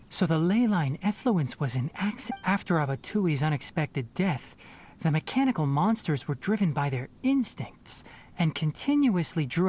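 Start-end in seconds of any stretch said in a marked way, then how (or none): none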